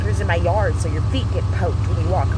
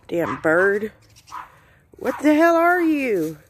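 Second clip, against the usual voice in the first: ordinary talk, nothing marked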